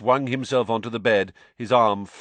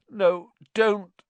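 usual speaking voice

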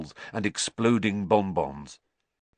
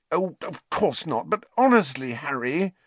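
high, louder fond exasperation; half laugh, half scold; exasperated; 7 semitones higher, 4 dB louder than usual. A narrator's reading. Hermione answering Harry's teasing, exasperated.

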